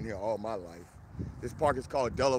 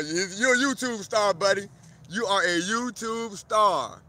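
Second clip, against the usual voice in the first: cooing at goose; 8 semitones higher, 8 dB louder, slower than usual. Real, not acted. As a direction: coax the goose like a pet star.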